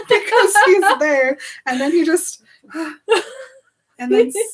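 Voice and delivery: words breaking up in high laughter; real giggling. Real, not acted. Telling what Edward was doing, helpless giggling. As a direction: struggle to talk through the giggles. Giggling while retelling the scene.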